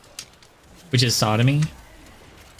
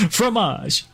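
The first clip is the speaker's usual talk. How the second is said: mock French word; 9 semitones higher than usual. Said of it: "if that's French for cheese".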